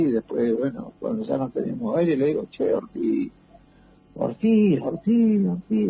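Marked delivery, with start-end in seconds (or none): 2.5-5.9 retold shout. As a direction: shout for the buried comrade, again and again. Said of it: "le grité, viste, un montón de veces". (grito agudo y fuerte llamando a Ortiz)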